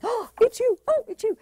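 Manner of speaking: high, delighted gasp and greeting; light up at seeing them; thrilled recognition. Retold or imitated. New partner greeting a loved one, high delighted. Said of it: "your sparkly bits are going".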